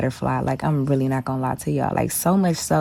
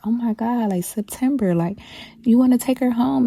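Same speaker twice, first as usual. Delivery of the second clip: much higher, gushing teacher voice; teacher's sweet coo; 9 semitones higher than usual. Retold or imitated. The science teacher offering the tearful kid the butterfly, gushing high. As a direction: coo at the crying child like a kind teacher. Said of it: "she was like".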